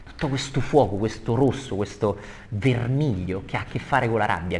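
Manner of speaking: normal talking voice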